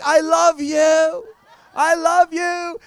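high, loud, long-drawn mock yell; imitated yelling. Stewardess yelling after the departing preacher, imitated adoring yell. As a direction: call it after someone, stretching the words. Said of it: "she's yelling after me in the airport".

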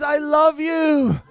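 loud, high, stretched-out mock adoration; gushing stewardess. Ticket lady declaring love to the traveler, mock gushing. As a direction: imitate her love-struck outburst, drawn out. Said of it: "She says".

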